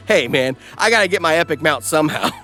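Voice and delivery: ordinary delivery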